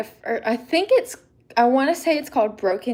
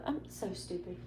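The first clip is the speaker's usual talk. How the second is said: usual speaking voice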